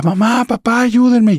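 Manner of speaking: high, loud panicked scream for parents; screaming for help. Retold or imitated. Terrified daughter screaming for her parents to help. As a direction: cry out to mom and dad in terror.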